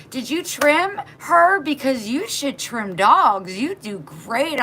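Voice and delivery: high, slow mock fussing; gushing neighbor. Neighbor holding the dog, slow high gushing. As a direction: coo over the dog like a busybody.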